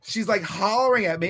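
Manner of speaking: ordinary talk, nothing marked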